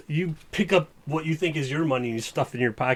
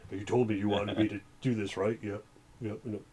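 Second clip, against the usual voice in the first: Lich Pogli impression; 5 semitones lower, 9 dB quieter than usual. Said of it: "that's my Lich Pogli impression".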